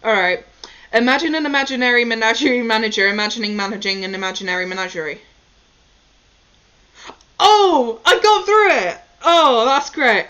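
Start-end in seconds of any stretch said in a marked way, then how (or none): 0.9-5.2 rapid-fire, no stumbling; fast tongue twister. Shown as a demonstration. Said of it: "let's do it quickly again". 7.4-10.3 loud, high, thrilled outburst; excited. Real, not acted. Nailing the fast tongue twister.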